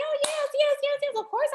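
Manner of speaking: high, eager yeses; excited. Retold or imitated. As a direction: gush like a delighted counselor. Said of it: "I was all excited".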